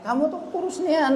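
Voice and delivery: mock teasing in a high voice; ejekan tinggi. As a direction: mimic a taunting kid. Orang mengejek anak kurus. (ejekan melengking menirukan teman)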